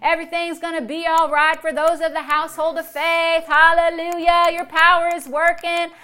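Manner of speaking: loud, exultant praise to God; rejoicing praise. Shown as a demonstration. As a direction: rejoice out loud with ringing hallelujahs. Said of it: "I can praise God and rejoice".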